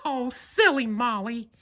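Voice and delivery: high falsetto mouse, gently teasing; teasing Mickey. A narrator's reading. Mickey teasing the scared cat, high and gentle. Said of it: "Mickey said".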